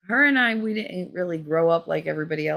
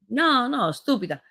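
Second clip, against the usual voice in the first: lightly voiced scolding mother, high first word; a hint of a strict Italian mother; 6 semitones higher than usual. Italian mother correcting a child's words, lightly mocked scolding. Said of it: "Her mother used to smack me".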